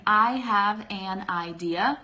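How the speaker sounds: slow, every word pronounced separately; slow, unshortened. Shown as a demonstration. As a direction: give each word its full form, unhurried. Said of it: "what's the difference".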